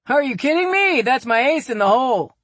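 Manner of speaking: a gleeful husband gloating over his trump card; mock-smug dad, loud and high; teasing dad. A narrator's reading. Dad laughs off wife's jab.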